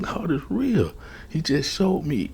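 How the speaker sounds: high, elated retold proclamation; excited. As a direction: proclaim it high, amazed at the proof. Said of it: "and I'm excited".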